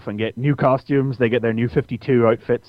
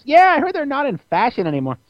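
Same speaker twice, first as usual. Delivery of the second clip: Robin voice; 13 semitones higher than usual. Retold or imitated. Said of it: "And Robin comes".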